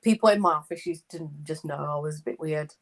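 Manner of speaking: ordinary delivery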